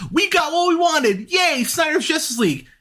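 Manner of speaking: fake-cheer like a smug fan; mock cheer. Mocking Snyder fans celebrating, sarcastic high cheer.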